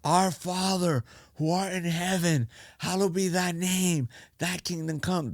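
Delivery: high, drawn-out Lord's Prayer; reenacted prayer, high and measured; recited prayer. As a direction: chant the prayer slowly, pitched well up. Reciting prayer desperately in retold story.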